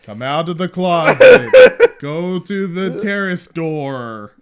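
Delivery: slow, drawn-out hypnotist commands; imitating a hypnotist, stretch out each word; mind-control command. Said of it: "he's trying to mind control".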